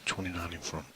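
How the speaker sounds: ordinary talk, nothing marked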